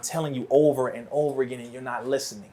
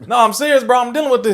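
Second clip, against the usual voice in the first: louder, higher, insistent plea; 9 semitones higher, 7 dB louder, faster than usual. Acted. Ignored man insisting to his friend, loud and urgent. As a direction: plead louder to be taken seriously. Acted plea building toward going wild.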